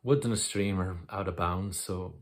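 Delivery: normal talking voice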